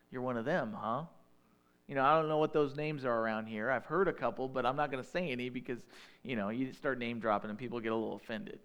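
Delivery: normal talking voice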